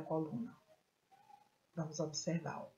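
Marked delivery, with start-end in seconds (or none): none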